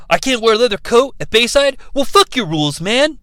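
lightly voiced rebellious punk girl; a hint of a defiant punk sneer; Tori snarling punk. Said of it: "That's my Tori impression".

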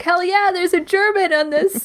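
high-pitched mock fan cheer; excited cheer. Co-host playing a viewer thrilled by German representation, excited cheer.

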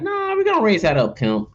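high-pitched mocking quote, dropping at the end; mock greedy executive. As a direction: lightly mimic a brazen price-hiker. Mocking voice of greedy food industries.

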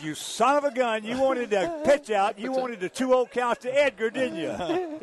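high, laughing, playful accusation; gleeful I-knew-it ribbing; mock accusation. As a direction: catch him out, half laughing. Teasing the manager on phone.

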